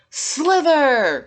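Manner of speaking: high, loud starting shout; shouted start call. Acted. Referee starting the snail race, loud shout. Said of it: "shouted the referee".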